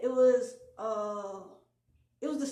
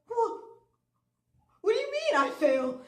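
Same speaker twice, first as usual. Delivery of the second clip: shocked disbelief; 10 semitones higher, 5 dB louder than usual. Retold or imitated. Told he failed the test.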